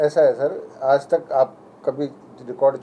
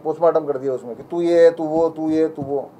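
ordinary delivery

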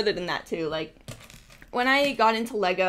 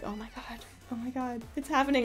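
stunned whisper; 3 semitones higher, 7 dB quieter than usual. Retold or imitated. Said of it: "And I was just like".

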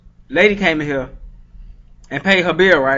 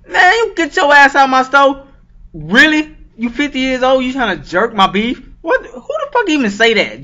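outraged shout; 7 semitones higher than usual. Retold or imitated. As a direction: throw her out of your store, appalled. Throwing old lady out, outraged.

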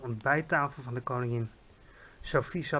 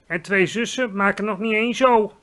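called out; 5 semitones higher, 7 dB louder than usual. A narrator's reading. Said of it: "riep de G V R".

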